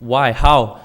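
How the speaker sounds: normal talking voice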